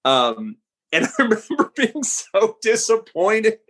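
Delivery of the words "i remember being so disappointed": higher-pitched, laughing through the words; laughing disappointment. Real, not acted. Writer confessing disappointment at the test screening, laughing. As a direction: let a laugh break into the confession.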